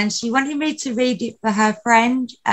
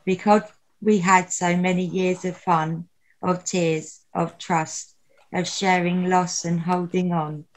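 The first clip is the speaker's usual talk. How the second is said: nervous open mic poet; 3 semitones lower than usual. Acted. Reads a memorial poem aloud.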